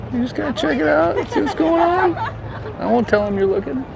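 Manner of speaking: high-pitched mom impression, coaxing; imitating mom. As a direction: do your mom egging you on to look. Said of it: "I get it from my mom".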